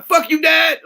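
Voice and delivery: high, loud bratty yell at dad; bratty kid yelling. Retold or imitated. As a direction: scream it like a spoiled teenager.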